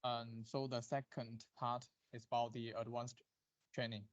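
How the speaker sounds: faint, far from the mic; barely audible voice; too quiet. Real, not acted. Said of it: "your voice is very low".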